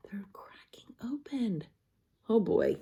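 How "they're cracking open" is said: softly whispered aside. Real, not acted. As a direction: secret whisper. Quiet aside.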